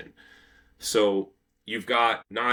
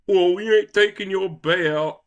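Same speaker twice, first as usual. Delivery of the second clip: high, loud mock British accent; a clumsy hammed-up British accent, higher and louder; bad British accent, half-hearted; 10 semitones higher, 8 dB louder than usual. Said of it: "I can't do a British accent at all".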